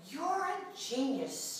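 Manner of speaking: slow, understated outburst of praise; a touch of delighted exclaiming; mild mock exclaim. Angel praising the Lord, mildly exclaimed. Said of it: "shouted the angel".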